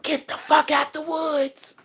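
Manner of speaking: slow, eerie hushed voice; hushed warning. Retold or imitated. Mother Earth warning the witches, slow and hushed. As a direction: breathe it out as the forest. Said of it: "telling them bitches".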